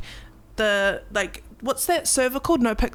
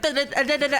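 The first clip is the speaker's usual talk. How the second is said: rapid "da-da-da" mimicry, pitched up; imitated mic chatter; 5 semitones higher, faster than usual. Interviewer mimicking a streamer going past on the mic, high chattering noise. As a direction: imitate someone rattling away on a mic. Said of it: "hear him on the mic going past".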